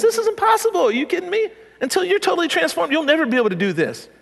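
high-pitched incredulous exclamation, easing down by the end. Retold or imitated. Jesus telling the man it can't be done, incredulous. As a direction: pitch it way up in disbelief.

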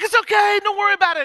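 high, loud, frantic it's okay; panicked reassurance. Retold or imitated. Dad to his son after the beaning, high and frantic. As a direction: squeak it out in a panic.

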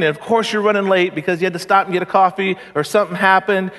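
usual speaking voice

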